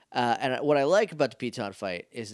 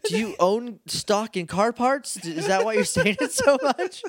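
high, mock-exasperated question; 8 semitones higher than usual. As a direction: needle them, pitched way up. Mocking character dialogue.

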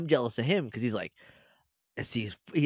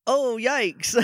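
mock yikes; 10 semitones higher than usual. Host imitates Lily reacting to Lewin, high and mocking. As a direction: squeak out the yikes. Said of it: "And she goes".

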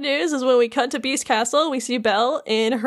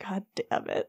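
softer grumbled curse to himself; under-breath curse; 3 semitones lower, 11 dB quieter than usual. Acted. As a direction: swear quietly facing away. Beast voiced, turned away.

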